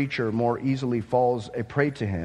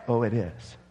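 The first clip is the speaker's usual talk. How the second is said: ordinary talk, nothing marked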